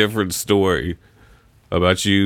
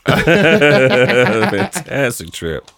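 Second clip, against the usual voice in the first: slow, louder, laughing drawn-out phrase; 4 semitones higher, 4 dB louder, much slower than usual. Real, not acted. Repeating his own phrase about the trip, laughing slowly. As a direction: stretch it out through laughter.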